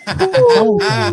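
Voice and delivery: slow, high, stretched-out word; drawn-out high tongue-tied. Real, not acted. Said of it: "that took a while".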